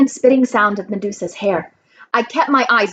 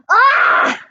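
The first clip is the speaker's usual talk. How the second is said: high, loud monster scream; sudden high shriek; pained yell; 14 semitones higher, 4 dB louder than usual. A narrator's reading. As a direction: scream out as if struck. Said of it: "Medusa yelled".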